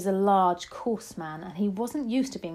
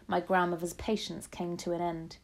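usual speaking voice

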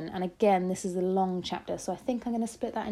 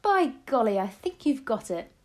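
excited; 4 semitones higher, 5 dB louder than usual. A narrator's reading. Grandmother delighted by plan.

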